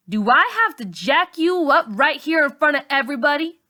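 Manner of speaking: put-on threatening girl. A narrator's reading. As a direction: lean in and threaten her. Char threatening friend.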